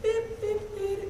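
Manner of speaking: high-pitched imitated beeps; joke beeping. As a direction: beep like a little machine.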